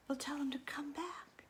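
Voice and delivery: put-on hushed secret whisper to the kids. Whispering to children about bringing the monster back. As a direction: lean in and whisper the question.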